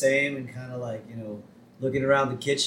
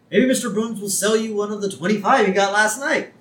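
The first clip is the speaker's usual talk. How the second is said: high, louder mock-helpful police chief; hammed-up clueless suggestion, pitched up; Wiggum; 8 semitones higher, 4 dB louder than usual. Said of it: "Wiggum is apologizing".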